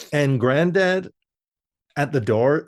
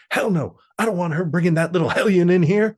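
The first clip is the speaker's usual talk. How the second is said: grumpy granddad; 6 semitones higher, 3 dB louder, faster than usual. Retold or imitated. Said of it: "He's like".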